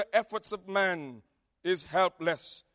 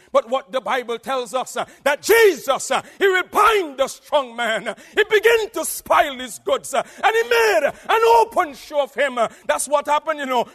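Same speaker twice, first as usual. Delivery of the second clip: loud, high triumphant sermon; full force preaching; 10 semitones higher, 12 dB louder than usual. Acted. Preacher proclaiming Jesus' victory, shouting.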